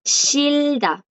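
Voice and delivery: Shilda held long and high, then dropping on da; silent J. Shown as a demonstration. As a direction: model Shilda slowly, R sounding as L and no h. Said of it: "no suena".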